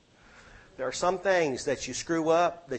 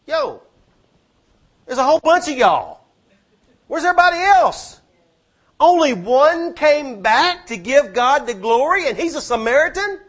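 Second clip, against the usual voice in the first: high, loud outraged calling out; incredulous shout; 10 semitones higher, 11 dB louder than usual. Retold or imitated. Preacher voicing God shouting for the missing nine, outraged. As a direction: yell it like God can't believe it.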